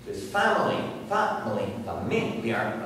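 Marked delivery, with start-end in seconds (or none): 0.2-1.7 "family" stressed on its first syllable. Shown as a demonstration. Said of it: "It's in different syllables". 1.8-2.8 "familiar" stressed on the second syllable. Shown as a demonstration. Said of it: "It's in different syllables".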